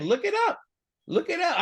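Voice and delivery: high-pitched imitation of the look-it-up line; mimicking his repeated line. Co-host quoting the speaker's catchphrase, high mimic. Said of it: "That's why he kept saying".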